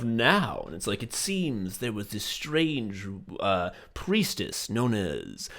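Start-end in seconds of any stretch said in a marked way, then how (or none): 1.0-5.5 slow, puzzled archaeologist from the future; halting mock-solemn discovery; mock future scholar. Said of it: "people from the future looking at cultural artifacts".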